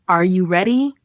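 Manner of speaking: "Are you ready?" with pitch climbing at the end; rising question intonation. Shown as a demonstration. Teacher shows learners how a question sounds, "Are you ready?" rising at the end. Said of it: "will have the intonation rising at the end".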